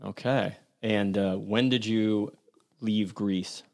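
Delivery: ordinary talk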